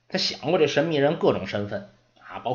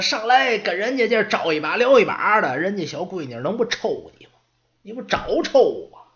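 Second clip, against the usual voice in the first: put-on scolding old servant; 5 semitones higher than usual. Old servant scolding the apprentice for provoking the orc, nagging scold. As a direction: chew the kid out.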